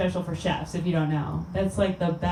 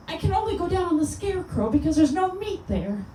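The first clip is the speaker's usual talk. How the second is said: a lightly sung, much higher starlet voice; 9 semitones higher than usual. Retold or imitated. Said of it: "here's [name] as a vegan".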